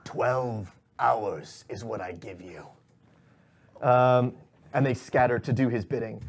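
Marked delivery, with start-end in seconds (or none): 0.0-2.7 low, very slow villain ultimatum; deliberate, lowered boss voice; shadowy boss. Acted. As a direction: lay down the deadline slowly, pitched down. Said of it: "he goes".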